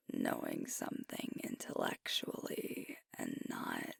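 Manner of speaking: soft close whisper, words barely voiced; intimate whisper. Alpha confessing self-doubt to his partner, in a whisper. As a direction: confide it in a hush, close to the ear.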